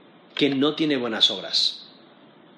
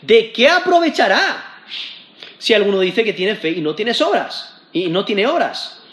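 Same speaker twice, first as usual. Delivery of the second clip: emphatic scripture reading; 5 semitones higher than usual. Acted. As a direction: preach the verse out, rising on "aprovechará". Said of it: "porque empezó diciendo".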